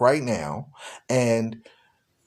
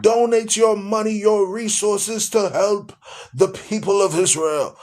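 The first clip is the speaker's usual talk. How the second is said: John Hagee; 6 semitones higher than usual. Retold or imitated. Mimicking a preacher appealing for donations, grandly proclaiming. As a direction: imitate a televangelist proclaiming with stretched-out words. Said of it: "That's how he talks".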